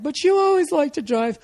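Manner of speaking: put-on high, puzzled girlfriend voice. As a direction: play the confused girlfriend way up high. Girlfriend questioning in the car.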